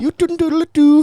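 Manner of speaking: very high squeaky mock Irish "do, do"; mock Irish singsong. Friend mocking the Irish, squeaky high mock Irish. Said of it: "this Irish is just".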